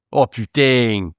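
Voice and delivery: ordinary delivery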